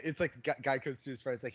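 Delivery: ordinary talk, nothing marked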